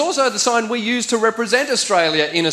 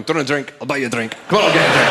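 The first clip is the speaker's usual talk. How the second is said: Glaswegian man offering drinks, lower then bursting out; 6 semitones lower, much faster than usual. Acted. Man in a Glasgow pub offering to buy a drink, Glaswegian. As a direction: put on a Glasgow pub accent. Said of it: "it's a Glaswegian man in a pub going".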